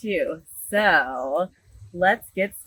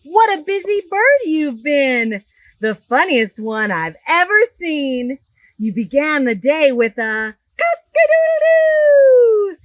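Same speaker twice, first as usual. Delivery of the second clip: bright, lively storybook read-aloud; animated picture-book reading; reading to children; 5 semitones higher, 8 dB louder than usual. Acted. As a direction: read it big for a room of little kids.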